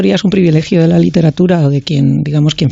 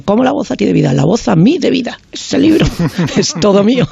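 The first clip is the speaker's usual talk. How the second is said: higher, half-laughing imitation of an outraged poet; mock indignant outburst; 5 semitones higher than usual. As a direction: play the offended poet yelling, laughing through it. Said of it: "pegando una voz y diciendo".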